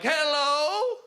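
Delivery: put-on high, thin old lady greeting; a hint of a frail old woman. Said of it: "a very old woman ... would answer the door".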